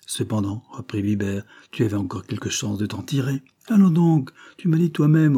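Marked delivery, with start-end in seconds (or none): 3.7-5.4 "Allons donc" flung out high and loud; scoffing outburst. A narrator's reading. Prisoner scoffing at his friend's hopes, loud and high. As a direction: scoff it loudly, rejecting hope.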